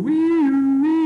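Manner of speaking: high siren wail. Retold or imitated. Teacher imitating a passing fire truck siren. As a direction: mimic a fire engine siren.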